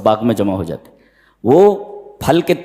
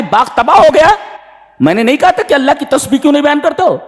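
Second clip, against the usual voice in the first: much higher, louder, fast rebuke; anguished reproach; 13 semitones higher, 4 dB louder, faster than usual. Retold or imitated. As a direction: cry out in blame at ruined garden. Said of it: "वो कह रहा है कि".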